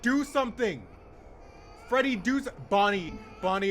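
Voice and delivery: loud, high pleading shout; urgent yelling. Real, not acted. Player yelling at Freddy and Bonnie to do something, urgent. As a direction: yell at them to act. Urging game characters to intervene.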